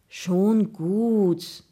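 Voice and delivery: drawn-out, pitying reassurance. A narrator's reading. Gregor interrupting his friend's excuses, pitying. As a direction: cut in with mild pity. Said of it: "unterbrach ihn Gregor mitleidig".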